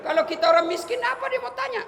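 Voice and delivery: high, loud mocking questioner; mock interrogator. Preacher mimics an angel grilling the poor, loud mocking questions.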